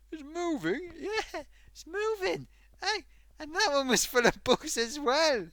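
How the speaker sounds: high, loud disbelief; mock alarm. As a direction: squeal it in hammed-up horror. Retold horror at bugs.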